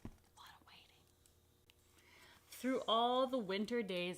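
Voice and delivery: storybook read with hushed pause; reading to children. Acted. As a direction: make the waiting feel long. Reading picture book to children.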